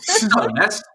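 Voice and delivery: host greeting the Pale King in jest; mock greeting. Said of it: "You have to say that".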